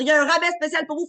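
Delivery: hammed-up announcer; mimicking the host. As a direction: announce the special discount like a host. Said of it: "quand elle va dire".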